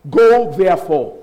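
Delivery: slow and loud, heavy on "Go"; emphatic command. Retold or imitated. Preacher repeating Jesus' commission, forceful command. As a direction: drive out a drawn-out "Go".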